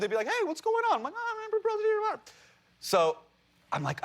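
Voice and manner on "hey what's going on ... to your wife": much higher, fast cartoonish voice; squeaky rushed voice; silly high voices. Parents and himself voiced.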